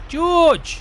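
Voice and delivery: loud call, pitched high, across the yard. Real, not acted. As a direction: shout his name to find him. Calling out across the farm.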